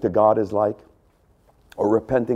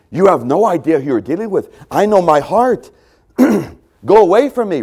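pleading Peter; 5 semitones higher, 5 dB louder than usual. Acted. Peter begging Jesus to go away, desperate. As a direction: beg him to leave, voice rising.

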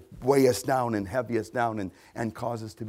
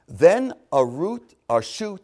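slow, raised, emphatic reading; slow scripture reading; 5 semitones higher, 8 dB louder, slower than usual. Real, not acted. Preacher reading Isaiah to the congregation, slow and emphatic. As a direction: read it out slowly and loudly, word by word. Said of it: "I'm gonna read them slowly".

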